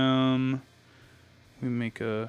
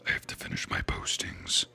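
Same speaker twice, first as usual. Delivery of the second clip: low, softer, whispery repeat; playful whisper; 5 dB quieter than usual. Pretend. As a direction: whisper it playfully, like a private reminder. Playfully repeats own chore remark.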